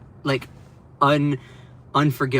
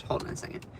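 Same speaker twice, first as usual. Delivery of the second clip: much softer, unvoiced hold-on; whispered aside; 14 dB quieter, faster than usual. Real, not acted. Vlogger whispering while pausing her stream. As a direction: whisper it away from the mic.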